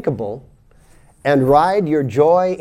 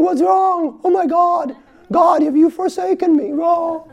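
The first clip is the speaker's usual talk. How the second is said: high panicked mock wail; 13 semitones higher than usual. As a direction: cry out to God in mock despair. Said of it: "you will think".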